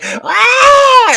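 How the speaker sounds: loud, high-pitched drawn-out scream; hysterical mock scream. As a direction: imitate a hysterical shriek at full volume. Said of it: "She starts to scream hysterically".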